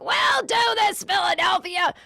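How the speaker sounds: mock top-of-the-voice rally shout; Hillary. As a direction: imitate a high, slow, strained speech yell. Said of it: "talk at the top of her voice".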